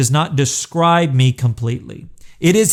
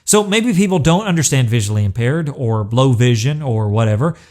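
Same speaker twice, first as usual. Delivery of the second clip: ordinary delivery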